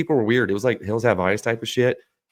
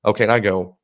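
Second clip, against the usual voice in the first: normal talking voice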